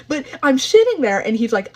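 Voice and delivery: usual speaking voice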